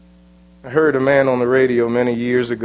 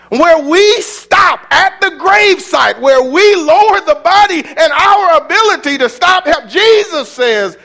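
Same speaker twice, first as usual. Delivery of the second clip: high, loud shouted preaching; preaching at full force; 14 semitones higher, 7 dB louder than usual. Acted. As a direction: cry it out over the congregation. Preacher peaks, then slows.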